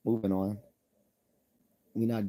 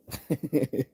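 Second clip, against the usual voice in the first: laugh; 6 semitones higher, 4 dB louder than usual. Real, not acted. Laughing after his own quip.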